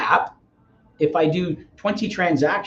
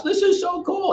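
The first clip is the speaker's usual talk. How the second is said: mock rooftop yell; slower than usual. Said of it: "when you're screaming from the rooftops".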